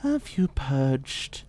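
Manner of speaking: soft menace. Acted. Vampire probes softly.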